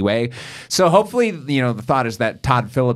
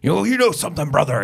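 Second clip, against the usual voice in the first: quick, lightly raised mock wrestler catchphrase; Hulk Hogan impression; 6 semitones higher than usual. Co-host riffing on Hulk Hogan's signature line, light impression. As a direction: toss off a playful hint of the Hogan voice. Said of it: "Hulk Hogan's the same way".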